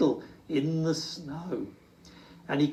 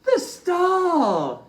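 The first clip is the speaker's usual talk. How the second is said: high, loud joyful cry; excited cry; 6 dB louder than usual. Acted. Little Bear cries out on finding the lost star, high and loud. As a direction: cry out with delight at the find. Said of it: "he cried".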